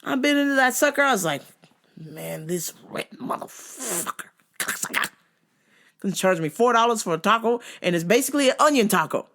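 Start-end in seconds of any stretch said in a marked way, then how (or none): 2.0-4.3 much softer, breathy under-the-breath whisper; whispered insult. Retold or imitated. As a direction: whisper it so the vendor won't hear. Muttering an insult under his breath.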